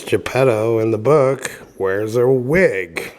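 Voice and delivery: ordinary delivery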